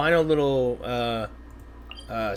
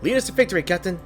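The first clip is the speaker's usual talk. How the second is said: bright squeaky sidekick, much higher; high excitable companion voice; 3 semitones higher than usual. Acted. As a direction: chirp it up like a tiny mascot. Streamer voicing the excitable companion.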